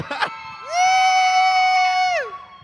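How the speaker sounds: high, loud delighted shriek of laughter. Real, not acted. Guest cheered by the crowd, shrieking with laughter. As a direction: let out a joyful shriek.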